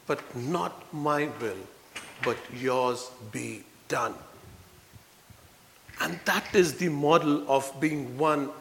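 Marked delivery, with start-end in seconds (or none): 0.1-4.1 soft, slow, yielding prayer; slow quiet prayer of giving in; slow hushed surrender. Retold or imitated. Jesus' surrender prayer, quoted.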